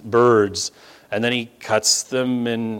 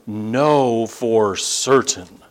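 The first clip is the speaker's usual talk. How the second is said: very slow, "Know" and "certain" each given weight; solemn, deliberate stress on "Know"; emphatic assurance; much slower than usual. Acted. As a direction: pronounce "Know for certain" word by word, drawn out. God's answer quoted for emphasis.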